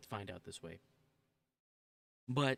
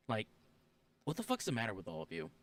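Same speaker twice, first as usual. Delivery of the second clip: ordinary talk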